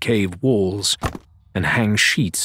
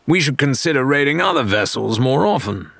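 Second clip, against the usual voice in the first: Rathbone; 4 semitones higher than usual. A narrator's reading. Rathbone voiced in story.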